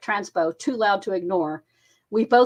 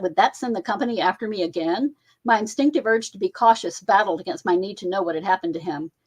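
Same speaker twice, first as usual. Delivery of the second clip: plain reading voice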